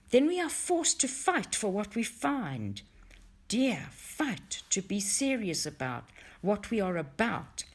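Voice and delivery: usual speaking voice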